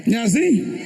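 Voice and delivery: ordinary talk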